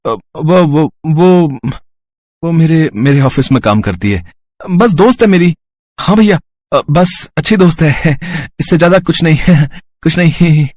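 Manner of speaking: flustered, high-pitched stammer. Acted. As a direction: stumble over a bashful cover-up. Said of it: "अकला क्यों रहे हो".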